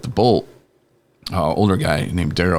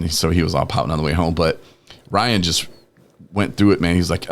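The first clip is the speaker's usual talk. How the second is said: normal talking voice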